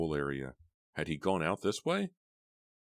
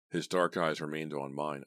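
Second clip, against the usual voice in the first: ordinary delivery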